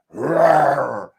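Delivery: short mock bear roar. Trader showing the chat the bear sound, a mock roar. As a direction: growl out a quick bear roar. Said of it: "you gotta think of a bear".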